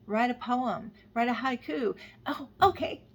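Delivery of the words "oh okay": sudden high, loud "oh okay" breaking into a laugh; surprised laugh. Real, not acted. Viewers hear her laugh at her own tangent, surprised and amused. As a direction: catch yourself and laugh it off.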